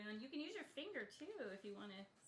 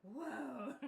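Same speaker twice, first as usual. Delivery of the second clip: amazed whoa; 4 semitones higher, 6 dB louder than usual. Retold or imitated. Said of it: "they're like".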